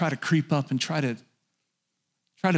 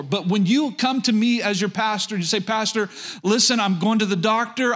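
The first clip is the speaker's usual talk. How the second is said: preaching; 6 semitones higher than usual. Acted. Preaching at full force.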